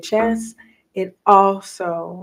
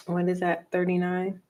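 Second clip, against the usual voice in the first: ordinary delivery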